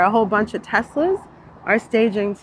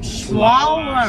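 put-on "Slalom" slurred like a drunk; 3 semitones higher than usual. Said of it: "You gotta say it like a drunk".

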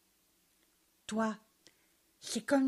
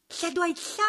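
exclaiming; 7 semitones higher than usual. A narrator's reading. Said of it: "s'exclama Joligrain".

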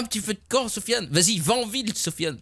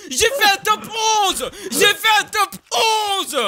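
high, slow, half-laughing cry of triumph; excited shout; 11 semitones higher, slower than usual. Real, not acted. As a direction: yell it out like you just won. Celebrating eleventh place finish.

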